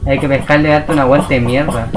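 ordinary talk

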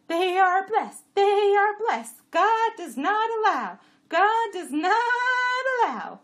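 high, loud, slowing chant; sleep-talker imitation. A narrator's reading. Jenny quoting her sleeping rider, high loud chant. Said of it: "Bilam was murmuring in his sleep".

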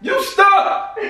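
heated, high-voiced retort; angry dismissal. Real, not acted. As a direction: shoot it back at full pitch. Snaps back at being called stuck.

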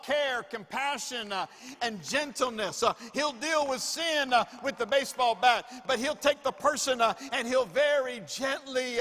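loud, emphatic preacher's cry; full-force preaching. Acted. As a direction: thunder it at the congregation.

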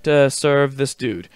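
usual speaking voice

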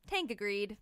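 ordinary delivery, nothing marked

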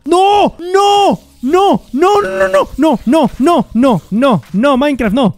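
loud, high screamed protests; anguished screaming. Real, not acted. Gamer protesting a disaster in Minecraft, loud high screams. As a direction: scream each one in despair, gradually coming down in pitch.

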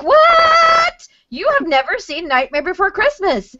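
shocked disbelief. Retold or imitated. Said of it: "we were like".

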